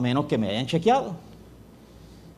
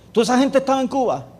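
high, loud, fast incredulous question; officer shocked that the whole plane was in Cuba; shocked disbelief; 11 semitones higher, 5 dB louder, faster than usual. Retold or imitated. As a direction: blurt it out in sheer disbelief.

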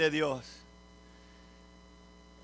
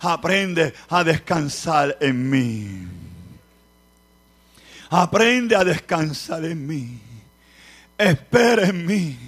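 slow, tender voice of God; gently, as God soothing him; voice of the Lord; 4 semitones lower, 6 dB louder, slower than usual. Acted. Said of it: "la voz del Señor que me ministra". (la voz del Señor, lenta y tierna)

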